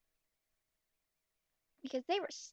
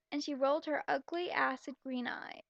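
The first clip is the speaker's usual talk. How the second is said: ordinary talk, nothing marked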